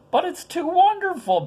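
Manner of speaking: put-on high-pitched amazed young Mary; Mary's amazement. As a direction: gasp it out like a young girl overwhelmed. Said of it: "Mary said".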